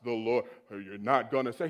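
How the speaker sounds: very low, mock voice of God; down, dark voice. Preacher mimicking expected voice of God, deep. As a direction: drop deep for a booming-God parody. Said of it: "down, dark voice".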